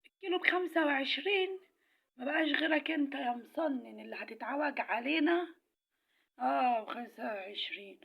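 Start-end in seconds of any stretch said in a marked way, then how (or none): 0.1-1.6 higher, scoffing question on وعشرين; mocking disbelief. Mocking the new high price. 6.4-8.0 slow, lower, smug اه stretched out; sarcastic drawl. Acted. Confirming the price with scorn.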